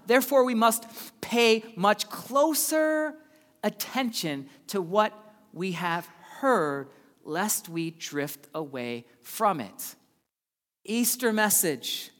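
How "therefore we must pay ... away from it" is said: slow, measured reading that fades; scripture reading. Acted. Pastor reading Hebrews to the church, slow and measured. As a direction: read it slow and weighty.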